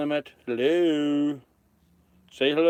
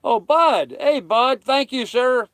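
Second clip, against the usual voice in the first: excited thanks; 7 semitones higher, 4 dB louder, slower than usual. Real, not acted.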